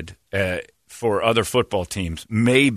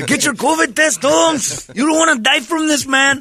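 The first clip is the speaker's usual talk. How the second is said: high, loud mock-Mexican accent; Mexican Statue of Liberty; 4 dB louder, slower than usual. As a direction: holler it like a costumed Mexican street hawker. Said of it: "a Mexican man dressed as a Statue of Liberty".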